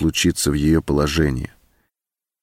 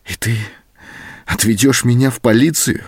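mildly faltering, higher-pitched question; 5 semitones higher than usual. A narrator's reading. Captive woman asking her captor about the police, voice faltering slightly. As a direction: let a touch of dread lift the voice. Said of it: "спросила она дрогнувшим голосом".

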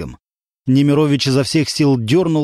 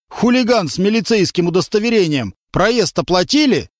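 stern driver; 6 semitones higher than usual. A narrator's reading. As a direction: grill the troublemaker. Driver confronts hero. (водитель строго допрашивает хулигана)

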